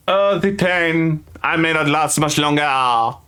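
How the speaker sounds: high, slow moaning of a melodramatic wounded noble; hammed-up dying groan of a pampered lord. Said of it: "the moaning noble".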